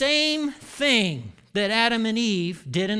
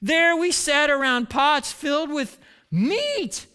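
slow, high hammed-up whine; theatrical grumbling, drawn out; moaning complaint; 7 semitones higher, 4 dB louder, slower than usual. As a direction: drag out the longing in a mock moan. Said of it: "they moaned".

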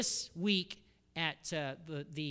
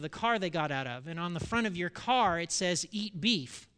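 casually explaining daughter; 3 semitones higher, 7 dB louder than usual. Retold or imitated. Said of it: "Jill explains the game".

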